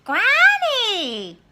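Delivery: put-on high, loud, slow vain girl; Little Red. Little Red admiring herself to Granny, high and slow.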